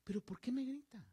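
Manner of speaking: hushed reply to a shouter; whispered question. Shown as a demonstration. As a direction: answer the yelling with a calm whisper. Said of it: "Dígale".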